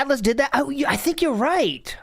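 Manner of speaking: surprised. Real, not acted. Suddenly realising Atlas did it.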